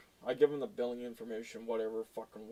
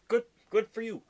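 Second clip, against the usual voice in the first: sarcastic retort; 9 semitones higher, 5 dB louder than usual. Real, not acted. Sarcastic retort to staring trucker.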